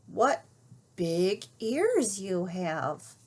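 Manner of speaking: slow, wondering delivery; slowly. Acted. Little Red slowly questioning the wolf in bed. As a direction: stretch out each word. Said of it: "she said slowly".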